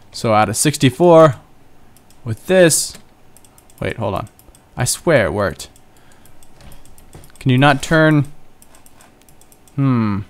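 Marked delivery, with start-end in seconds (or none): none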